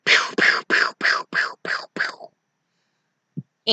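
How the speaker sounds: whispered pew pew fading away; fading mock gunfire; gunshot noises. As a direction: mimic pistol shots under the breath. Gunfire in Sam impression.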